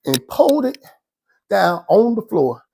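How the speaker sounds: ordinary talk, nothing marked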